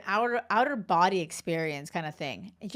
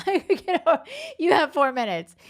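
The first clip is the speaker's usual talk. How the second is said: louder, teasing push; playful scolding, louder; laughing, emphatic scold; 7 semitones higher, 7 dB louder than usual. Real, not acted. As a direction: laugh it off while insisting.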